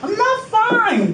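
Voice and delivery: high, slow, pleading; distressed plea. Real, not acted. Detainee tells officer she's unwell, high slow plea. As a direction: stretch it out high and upset.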